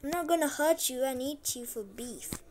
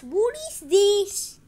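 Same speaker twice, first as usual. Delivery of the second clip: surprised question; 7 semitones higher, 8 dB louder, much slower than usual. Real, not acted. Surprised at new item.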